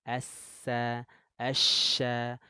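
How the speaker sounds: asya with spread airy sh, asa with sharp s; shin versus sin. Shown as a demonstration. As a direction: contrast the sh and s. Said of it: "Beda ya".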